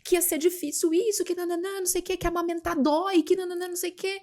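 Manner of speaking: lightly imitating a friend's grumbling; mock angry complaint. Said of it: "brava comigo". (imitação leve de amiga brava, aguda e baixa)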